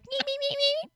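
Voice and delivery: high, quick chirping me sounds; cat chirping. Retold or imitated. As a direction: chirp like an excited kitten. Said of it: "a little chirper".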